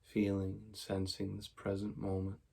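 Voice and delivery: soft, even meditation voice with long pauses; quiet, unhurried mindfulness guidance; guided meditation. Real, not acted. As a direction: guide them gently into stillness, leaving space after each phrase.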